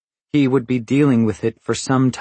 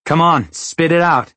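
raised, louder, insistent interrogation; pressing demand; 7 semitones higher, 5 dB louder than usual. A narrator's reading. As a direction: press hard for an answer. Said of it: "I pressed him".

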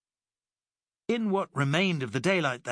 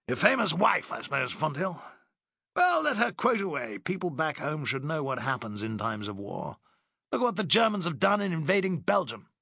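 higher, breezy condescending officer voice; dismissive Driscoll; 5 semitones higher than usual. Acted. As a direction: brush him off airily. Driscoll sniffs at Alice.

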